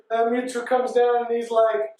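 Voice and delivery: usual speaking voice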